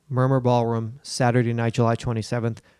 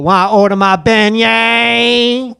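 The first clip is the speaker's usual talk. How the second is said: slow, high, loud put-on New Orleans accent; 7 semitones higher, 6 dB louder, slower than usual. Host orders a beignet at Cafe Du Monde in a slow, thick New Orleans accent. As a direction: drag it out like a local ordering. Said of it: "I'm gonna talk like that".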